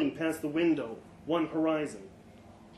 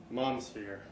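matter-of-fact; 4 semitones lower, 6 dB quieter than usual. Acted. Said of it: "matter-of-factly".

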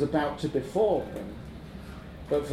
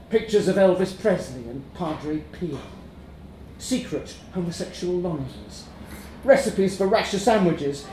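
plain reading voice

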